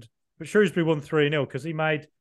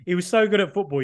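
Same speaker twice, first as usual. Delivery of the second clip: usual speaking voice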